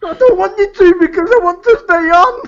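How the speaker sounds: high and loud, half laughing; gleefully refusing to stop; excited. Real, not acted. Co-host begging to stay on air, high and loud.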